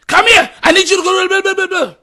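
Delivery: imitating a mother hollering instructions; parent yelling. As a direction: bark orders like a busy mom. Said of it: "And they were shouting loud".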